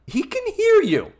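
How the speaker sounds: loud, very high-pitched protest; mock exasperated yell. Host protesting at a sitcom stage whisper, exasperated high yell. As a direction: shout it in comic disbelief.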